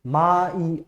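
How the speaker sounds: ordinary talk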